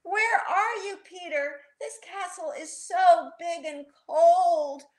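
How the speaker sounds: put-on storybook child feeling lost and chilly; uneasy. A narrator's reading. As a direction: call out for a lost brother. Child lost in a cold castle.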